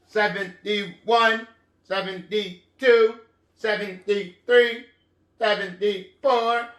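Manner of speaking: syllable-by-syllable robot counting. Shown as a demonstration. Teacher counting with children in a robot voice. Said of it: "do it in a robot voice".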